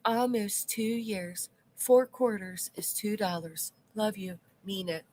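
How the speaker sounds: hushed slow mock-sweet reading; soft put-on reading. Reading member message in voice.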